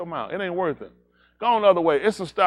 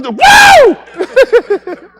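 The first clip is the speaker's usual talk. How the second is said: loud, very high whoop; wild whoop; 14 dB louder than usual. Retold or imitated. Friend whooping when he sees the girl, loud. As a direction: shout it out gleefully.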